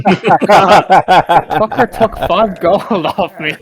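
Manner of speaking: slow, laughing through the words; laughing complaint. Real, not acted. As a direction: grumble while cracking up.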